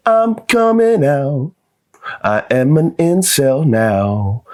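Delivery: high, slow proclamation; slow drawn-out announcement. Pretend. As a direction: stretch it out grandly.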